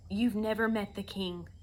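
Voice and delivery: usual speaking voice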